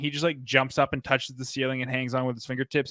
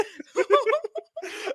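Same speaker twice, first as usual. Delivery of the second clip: high burst of laughter. Real, not acted. Laughing at the movie.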